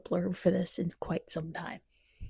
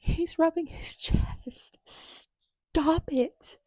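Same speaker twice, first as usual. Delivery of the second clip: slow, higher unvoiced whisper; swooning whisper; 7 semitones higher, much slower than usual. Real, not acted. Reader swooning as the hero rubs his chest, whispered. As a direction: whisper it like you're melting.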